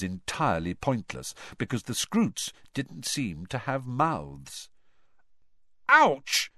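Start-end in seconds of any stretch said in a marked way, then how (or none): 5.9-6.5 sharp high yelp, much louder; yelp of pain. A narrator's reading. Boy bitten by a creature yelps out loud. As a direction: cry out as it bites you. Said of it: "yelled Dean Thomas".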